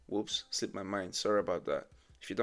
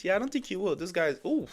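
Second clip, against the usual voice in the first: excited reaction; 9 semitones higher, 6 dB louder than usual. Real, not acted.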